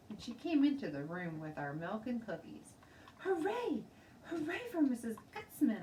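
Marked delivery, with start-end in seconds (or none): 3.2-5.8 high, loud cheer; shouting. A narrator's reading. Little girl shouting hooray at her teacher. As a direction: yell it out gleefully. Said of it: "I shouted at her".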